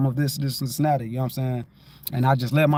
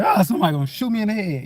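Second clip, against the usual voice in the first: mock fearful blurt; 5 semitones higher, much faster than usual. Recalls fearing being shot.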